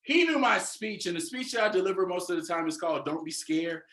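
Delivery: ordinary talk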